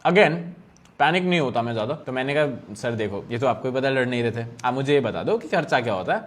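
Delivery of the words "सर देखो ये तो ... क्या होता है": soft placating plea. Retold or imitated. Calming angry cop, retold.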